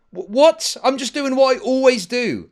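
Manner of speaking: high, loud, protesting innocence; mock bafflement. Streamer replaying his answer to angry followers, incredulous. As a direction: act out an incredulous reply.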